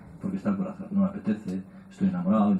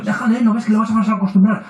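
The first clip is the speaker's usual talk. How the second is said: much higher, louder scolding father; stern father; 11 semitones higher, 8 dB louder than usual. Retold or imitated. Father scolding the mother for picking up the baby, higher and louder. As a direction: scold her, half laughing, for spoiling the child. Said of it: "el padre dice".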